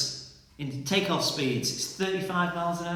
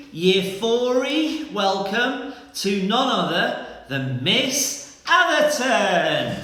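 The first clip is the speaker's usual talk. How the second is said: higher, louder, slow game-show announcer build-up; big guest introduction; 6 semitones higher, 3 dB louder, slower than usual. Acted. Teacher hypes up the special guest for the class, announcer style. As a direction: stretch it out like a show host.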